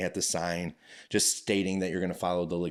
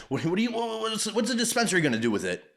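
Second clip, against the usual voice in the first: incredulous outburst; 13 semitones higher, faster than usual. Real, not acted. Incredulous rant about customers.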